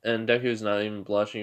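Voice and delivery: usual speaking voice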